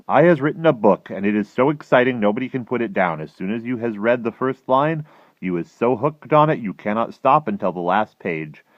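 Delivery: loud, animated storytelling; dream label read aloud. A narrator's reading.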